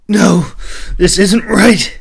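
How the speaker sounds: high, loud and slow, fighting back tears; the prince begs, breaking into a high cry; tearful protest. A narrator's reading. Prince protesting his sister's banishment, high and loud. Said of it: "he protested".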